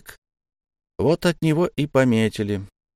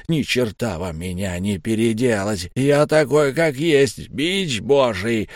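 a hint of a furious beast growling; guttural beast voice. A narrator's reading. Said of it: "низкий, гортанный. рычание взбешённого зверя". (низкий гортанный рык)

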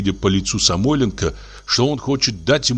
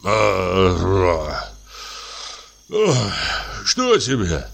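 heavy breathing; much slower than usual. A narrator's reading. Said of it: "тяжело дыша, с просонок".

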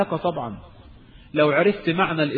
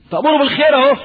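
loud and high burst; outraged exclamation; 9 semitones higher, 7 dB louder, much faster than usual. Real, not acted. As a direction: spit out the injustice. Outraged setup of the threat.

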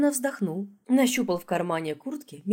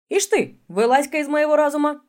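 loud, much higher indignant demand; 9 semitones higher, 8 dB louder than usual. Acted. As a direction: order him out indignantly. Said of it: "потребовал".

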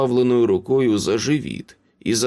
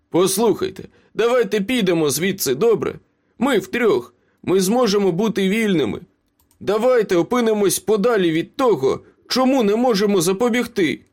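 high-pitched, hurried appeal; urgent persuasion; 10 semitones higher than usual. A narrator's reading. Ace urges his two friends to escape, pleading. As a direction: coax them urgently to get away.

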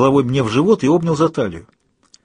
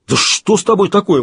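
higher, louder outraged question; an indignant, raised protest; 7 semitones higher, 4 dB louder than usual. Acted. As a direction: demand it in outrage. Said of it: "возмутился Кайл".

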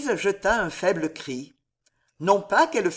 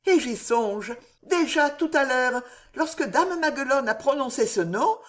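anxious pleading; 7 semitones higher, 3 dB louder than usual. Acted. Frightened daughter pleads with mother.

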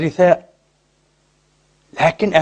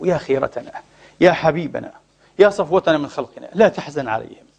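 tender consolation; 4 semitones lower, 3 dB quieter than usual. Acted. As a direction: console gently, lingering on each phrase. Voices tender divine consolation to Prophet. (مواساة رقيقة بصوت منخفض)

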